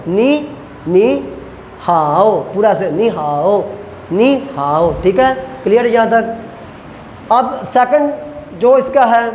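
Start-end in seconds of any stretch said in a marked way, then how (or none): 0.1-4.9 नी drawn out slow with a rising second tone, then हाओ low; teacher sounds out नी हाओ slowly, नी rising, हाओ dipping low; slow tone demo. Shown as a demonstration. Teacher models Mandarin tones for students, slow and careful. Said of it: "यहाँ पे मैं second tone में पढ़ रहा हूँ".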